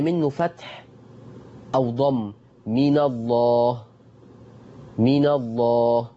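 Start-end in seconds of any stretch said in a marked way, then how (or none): 2.7-3.8 من الله drawn out slowly with a dark, thick "ll"; heavy lam. Shown as a demonstration. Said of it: "كنا برضو بنفخمه". 5.0-6.1 من الله drawn out slowly with a dark, thick "ll"; heavy lam. Shown as a demonstration. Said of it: "كنا برضو بنفخمه".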